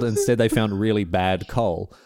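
ordinary talk

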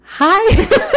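high, loud burst of a hi; excited hi. Shown as a demonstration. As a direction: throw real oomph into it. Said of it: "with more oomph to it".

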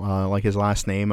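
ordinary talk

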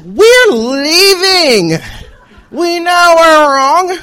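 long drawn-out, sing-out protest; exasperated yell. Acted. Students yelling back as they leave, exasperated drawn-out yell. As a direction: stretch each word out slowly in protest. Acted shout back while leaving.

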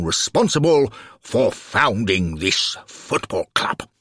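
pompous, booming Alderman; big, ceremonious speech-making voice. A narrator's reading. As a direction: proclaim it like a self-important civic dignitary. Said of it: "say in his booming voice".

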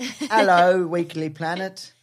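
hammed-up Cockney greeting, slow; a jokey Cockney hello; playful Cockney accent greeting the listeners; mock Cockney. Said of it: "It could be Cockney".

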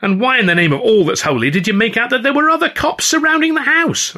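very high outraged demand; angry demand. A narrator's reading. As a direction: hurl the question. Angry confrontation continues.

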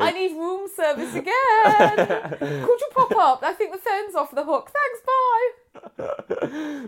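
high-pitched, playful put-on phone voice; hammed-up hotel guest. Hotel guest ringing for room service as an excuse, hammed-up flirty call. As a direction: sing it out high, angling for another visit.

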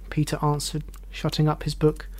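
plain reading voice

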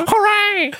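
very high, squeaky puppet hooray; pig puppet cheer. Retold or imitated. Host mimics Huskus the pig cheering, high puppet voice. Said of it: "and Huskus goes".

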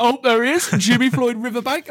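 imitating dad. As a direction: tease like a dad cracking a pun. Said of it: "'Cause he'd be like".

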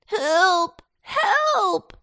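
high-pitched, drawn cry for help; bawling for help. A narrator's reading. Captured potato calls to his friends, bawling high. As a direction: bawl it out high and loud. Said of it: "bawled Potato".

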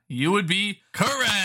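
slow, high game-show reveal; drawn-out reveal. Real, not acted. Host reveals the right answer to player, drawn-out announcement. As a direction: draw out the verdict like a quiz host.

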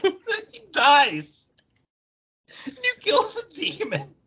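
high, giggling through the words; breaking up with laughter; laughing. Real, not acted. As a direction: laugh it out as you tell the ending.

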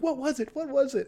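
high, loud mock-nagging voice; squeaky insistent questioning; pestering crowd. Imitating everyone pestering about forgotten item.

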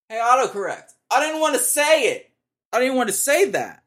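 high, loud exasperated yell; yelling at autocorrect. Real, not acted. Venting at autocorrect, a loud frustrated yell. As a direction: shout your frustration at the phone.

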